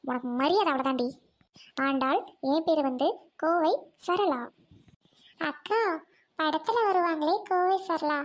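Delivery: ordinary talk, nothing marked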